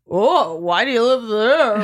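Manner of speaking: exaggerated condescending "oh", dragged out slowly. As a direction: imitate someone looking down on where they live. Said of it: "so condescending".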